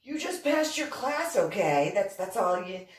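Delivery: loud, emphatic brush-off; exasperated insistence. Retold or imitated. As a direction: insist loudly, shutting down the teasing. Retold teacher insisting, exasperated.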